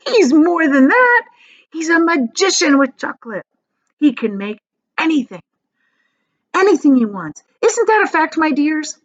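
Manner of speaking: high, loud, eager praise; enthusiastic grandpa. Acted. Grandpa Joe praising Wonka to Charlie, high and eager. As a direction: gush about the magician.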